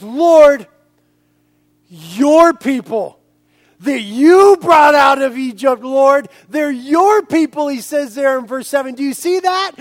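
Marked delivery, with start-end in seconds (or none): 0.0-7.7 Moses pleading. Acted. Moses begging God to spare Israel, loud pleading cry. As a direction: cry out to the Lord to spare them. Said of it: "And he says".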